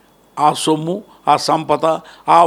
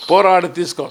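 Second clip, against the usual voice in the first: impassioned public speech, swelling on the calls; stirring address to mothers and youth; fiery oration; 4 semitones higher, slower than usual. Acted. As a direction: rouse the crowd like a folk orator.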